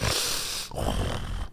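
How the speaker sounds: soft, breathy exhale; sigh. Real, not acted. Recounting a big night out, quiet sigh.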